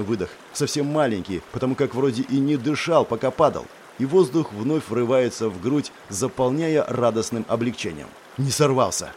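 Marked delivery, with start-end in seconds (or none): none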